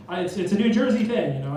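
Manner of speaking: usual speaking voice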